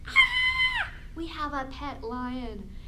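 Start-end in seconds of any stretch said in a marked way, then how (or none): 0.1-0.9 high, loud mock shriek; sudden high-pitched yelp; mock scream. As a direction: scream in pretend fright at a lion. Mock scare at pet lion.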